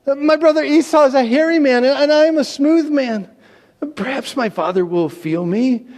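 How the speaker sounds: a son panicking about being found out; high fretful protest; anxious Jacob. Acted. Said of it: "But Jacob said".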